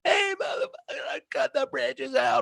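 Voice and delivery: very high, strained yell; screaming warning. Retold or imitated. Warning speeding drivers the bridge is out, screaming. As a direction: scream at passing cars. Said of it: "if the bridge was out".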